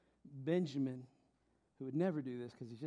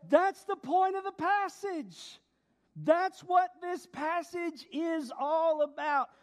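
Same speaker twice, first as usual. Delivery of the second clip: high, loud, driving sermon climax; full-force preaching; 11 semitones higher, 9 dB louder than usual. Real, not acted. Preacher proclaiming to the congregation that Jesus is God, full-force preaching. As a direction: build to a ringing proclamation.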